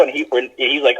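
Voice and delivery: usual speaking voice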